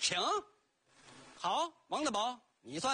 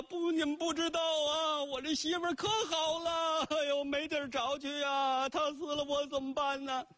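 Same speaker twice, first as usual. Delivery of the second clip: a touch of keening in a raised voice; mock wailing lament; 7 semitones higher than usual. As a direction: cry it out high as a husband who lost his wife. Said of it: "放声痛哭".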